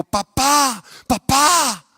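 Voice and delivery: put-on high, loud anguished cry of a boy; a frightened son's pleading cry. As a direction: call out to your father in distress. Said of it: "nada más escuchaban los gritos".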